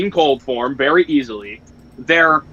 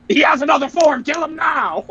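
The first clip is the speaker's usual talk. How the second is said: urgent yell; 10 semitones higher than usual. Retold or imitated. Vegeta yelling at Goku to finish Frieza, high urgent shout. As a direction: yell the warning like it's life or death.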